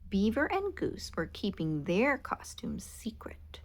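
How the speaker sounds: plain reading voice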